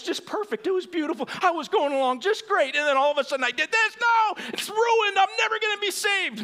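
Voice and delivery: panicked cry, rising into a shout; mock panic. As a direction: wail like a believer who thinks all is lost. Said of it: "Calm down, [name]".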